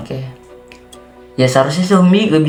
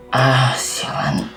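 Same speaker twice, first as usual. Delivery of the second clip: weary curse; 3 dB quieter, much slower than usual. Acted. Dizzy and worn out after attack.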